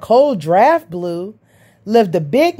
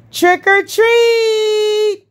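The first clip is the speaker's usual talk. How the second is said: put-on children yelling; 11 semitones higher, much slower than usual. A narrator's reading. Children at the haunted house door, yelling high. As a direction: yell it out like eager kids. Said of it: "yelled the children".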